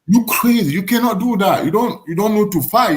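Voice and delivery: mock friends' scolding. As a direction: play the friends shouting him down. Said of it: "they say".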